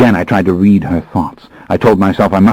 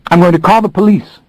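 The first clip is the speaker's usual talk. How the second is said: put-on young woman's higher threat, slightly shaky; quavering slightly; 12 semitones higher, 4 dB louder, faster than usual. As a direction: threaten the police, nervous underneath. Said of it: "her voice quavering slightly".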